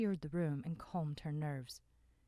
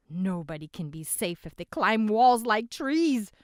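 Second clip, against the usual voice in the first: loud rough bellow; a rough man bellowing in alarm; 6 semitones higher, 14 dB louder than usual. A narrator's reading. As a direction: bellow it over the room. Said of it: "the rough voice bellowed".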